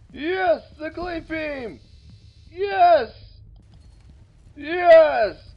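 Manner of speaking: loud triumphant yes; excited cheering. Real, not acted. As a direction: cheer like you just won. Weapon fires, cheering.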